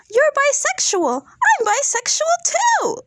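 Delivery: very high-pitched imitated squeal, last words stretched; mock overexcitement. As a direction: gush in a hammed-up high voice, imitating her. Imitates guest's overexcited reaction to daughter.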